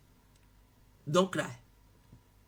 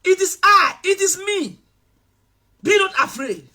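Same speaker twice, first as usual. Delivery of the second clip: higher, firm cheering voice; raised reassuring call; 8 semitones higher than usual. Retold or imitated. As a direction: lift them up, call across the storm.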